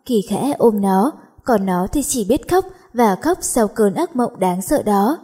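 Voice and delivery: ordinary delivery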